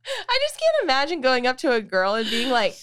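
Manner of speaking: ordinary talk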